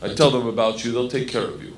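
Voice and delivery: put-on low, reassuring Russian accent; Igor. Gangster promising a friend protection on the train, low Russian accent. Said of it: "And he goes".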